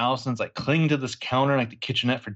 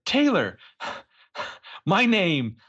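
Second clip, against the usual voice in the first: higher, sighing mock plea; hammed-up soap-opera sigh; imitating Allison; 7 semitones higher, much slower than usual. Allison pleading with Taylor, higher sighing mock plea. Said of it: "And Allison's like".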